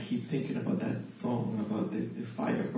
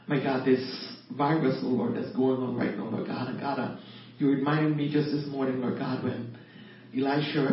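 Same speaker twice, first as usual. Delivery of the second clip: louder, raised praying voice, building in urgency; fervent prayer; 2 semitones higher, 6 dB louder than usual. Acted. Pleading God's promise of protection for everyone, fervent prayer. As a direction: pray with conviction, leaning on key phrases.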